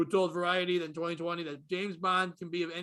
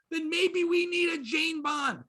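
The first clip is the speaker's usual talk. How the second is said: higher, slow punchline shout; 8 semitones higher, 3 dB louder, slower than usual. Acted. Comic shouts his punchline about a Jane Bond. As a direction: land the joke with a slow shout.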